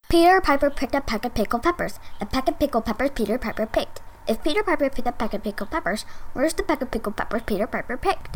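rattled off fast and soft; super fast. Shown as a demonstration. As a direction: race through it as fast as you can. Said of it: "You mean super fast?".

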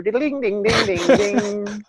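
high mock banjo twanging; imitated banjo. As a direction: sing out plucked banjo notes. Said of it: "I can almost hear the banjo".

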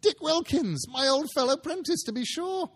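put-on an old man suddenly young with delight; delighted recognition. A narrator's reading. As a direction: Scrooge recognising his old friend, excited. Scrooge delighted at old friend.

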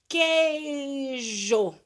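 loud, long drawn-out "queijo", KEI-jo with no U sound; silent U. Shown as a demonstration. Teacher showing pupils the U is not heard, drawn-out silent-U demo. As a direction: stretch "queijo" as KEEEI-jo. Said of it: "a gente não fala o sonzinho do U".

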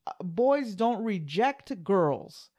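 slow, drawn-out sarcastic mock. Husband mocking his wife's claim, sarcastic and slow. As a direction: stretch it out to mock her logic.

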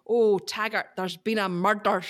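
imitating a rolled-R Scottish detective; mock Scottish accent. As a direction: tease with a Taggart line, Rs rolled. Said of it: "they'd all go ... rolling of the R's".

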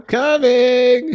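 long, high, loud singsong call. Pretend. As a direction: stretch it out like a distant shout. Calling out as the recession.

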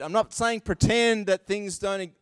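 usual speaking voice

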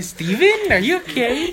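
high, laughing concern; mock concern. Friend teasing group about Steven, high mock worry. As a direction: pitch up in fake worry. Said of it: "You're like".

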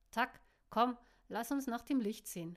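loud, curt order to get moving; brusque demand. A narrator's reading. Caro ordering Tack out of the bar, loud and curt. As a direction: push him roughly to come along. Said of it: "forderte Caro ihn unsanft auf".

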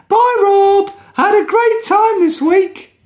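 very high, squeaky little creature voice; happy talking episode. Pretend. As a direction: squeal a drawn-out, happy goodbye in a tiny voice. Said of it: "The episode is very happy and really wants to speak to you".

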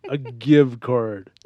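"gift" drawn out slowly as "give"; slow, exasperated give card for "gift card"; mocking the typo. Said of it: "What is a give card".

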